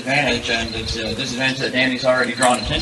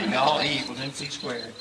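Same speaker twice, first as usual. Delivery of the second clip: mock hillbilly Southern accent; 7 dB quieter than usual. Southern debater joking about his own accent, mock hillbilly Southern. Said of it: "make it sound hillbilly".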